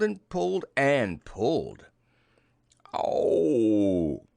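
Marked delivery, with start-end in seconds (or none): 2.9-4.3 loud drawn-out "oh" of pain; long acted yelp, sliding down; hammed-up storybook ouch; cry of pain. A narrator's reading. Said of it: "cried Pooh".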